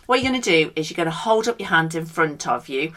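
ordinary talk

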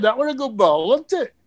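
cheeky mock-innocent question, rising high; cheeky boy batter. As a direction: lightly mimic a cheeky kid, pitch lifting.

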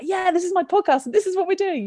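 a playful take on her professional mode; mock host voice. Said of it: "you switched into profession mode".

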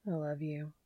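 soft mumbled confession. A narrator's reading. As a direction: say it under your breath. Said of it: "he mumbled".

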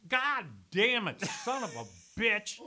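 put-on slow, loud drunken swearing; cursing slowly, slurred with drink. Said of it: "so they can hear him doing this stuff".

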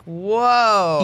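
loud overwhelmed whoa. Retold or imitated. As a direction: blurt it out, stunned by the rush. Overwhelmed by rushed timeline.

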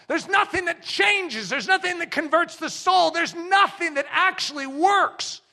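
preacher's shout; forceful preaching. Acted. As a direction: hammer each line home. Preaching climax.

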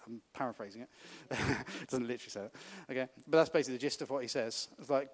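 usual speaking voice

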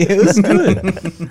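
laughing through it, drawn out slow; laughing happily at his own success. Real, not acted. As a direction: giggle the words out slowly. Laughing while recalling.

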